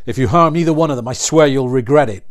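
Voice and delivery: loud, fast, angry warning; furious threat. A narrator's reading. Daniel furiously warns the outlaws not to harm his family, loud and fast. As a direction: threaten them through rising rage.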